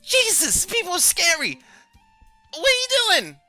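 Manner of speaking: very high, loud panicked shriek; freaked-out creature, shrill and loud. Acted. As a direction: yelp it out in a squeaky panic. Crick panics at offered blade.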